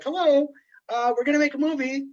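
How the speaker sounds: high, loud chirpy newcomer voice; mock studio guy. New filmmakers barging in, high and breezy mock voice. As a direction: play the breezy outsider announcing a movie. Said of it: "they're like".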